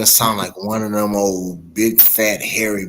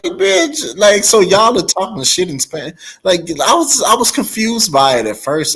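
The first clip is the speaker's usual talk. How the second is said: 1 incredulous; 7 semitones higher, 3 dB louder than usual. Retold or imitated. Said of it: "I'm like".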